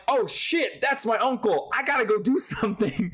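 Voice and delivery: retold alarm. Retells urge to help uncle.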